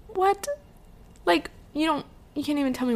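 high, incredulous squeak of disbelief; baffled what. Retold or imitated. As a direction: react like you can't believe the rudeness. Retelling baffled reaction to airport clerk.

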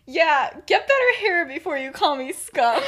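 high, loud theatrical insult; mock-offended comeback. James finally replying to being called scum, mock-offended. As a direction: fling it back, hammed-up.

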